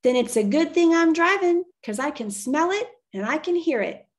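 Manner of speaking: loud, high shout, easing off at the end; yelled reply. Acted. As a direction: holler back over the wind, confident. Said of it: "yelled Charlotte".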